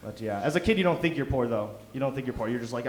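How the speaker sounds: ordinary talk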